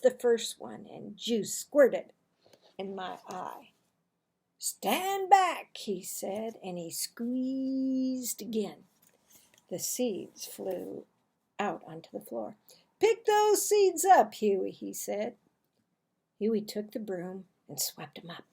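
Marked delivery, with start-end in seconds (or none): 4.6-5.8 high, loud warning; father's sharp warning; warning call. Acted. Father warning boys while squeezing. 13.0-14.7 put-on high, loud order to the boy; father's loud command; bossy command.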